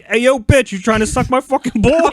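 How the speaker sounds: loud, high brash New York yell; New York accent. Retold or imitated. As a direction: bark it like a Brooklyn tough guy. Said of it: "I said it in, like, a New York accent".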